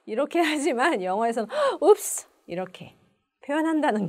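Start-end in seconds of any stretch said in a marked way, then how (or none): 1.8-2.3 high, loud gasped oops; startled. Shown as a demonstration. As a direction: blurt it on seeing someone unexpected. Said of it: "딱 돌아봤는데 친구가 있는 거예요". (깜짝 놀라서 내는 소리)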